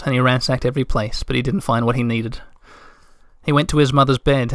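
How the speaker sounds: ordinary delivery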